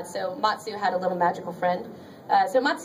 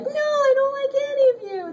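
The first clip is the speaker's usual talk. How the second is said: high, mock-wailing boy voice; whiny anime boy; 4 dB louder than usual. Mocking the boy who rejects the magical girls, whiny wail. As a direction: whine it far up high like an overwrought boy. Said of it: "that boy is like".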